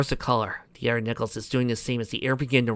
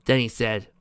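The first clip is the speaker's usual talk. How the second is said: plain reading voice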